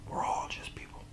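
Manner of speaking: breathy whispered reminder. Real, not acted. Whispering to the viewers that we're all people. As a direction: whisper it as a quiet truth. Whispered aside after a rant.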